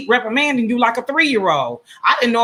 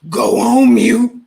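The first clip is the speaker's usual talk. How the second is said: "Go on mute" stretched out, louder, taunting; mocking louder slow; 3 semitones higher, 8 dB louder, much slower than usual. Host taunts the caller, slow and loud, about muting. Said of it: "That's what you do".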